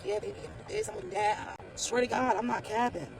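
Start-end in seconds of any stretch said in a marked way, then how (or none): 0.1-1.6 voiceless whisper, imitating her sultry talk; whispered quote. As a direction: breathe it like a phone flirt, no voice. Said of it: "She's talking like".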